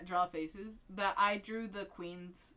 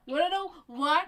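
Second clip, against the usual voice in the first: excited tease; 7 semitones higher, 9 dB louder than usual. Real, not acted. Excited teasing question.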